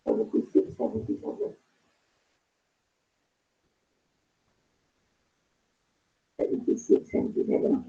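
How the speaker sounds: half-whispered counting under breath; muttering to herself. Real, not acted. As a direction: murmur the numbers quietly to yourself. Teacher checking numbers to herself.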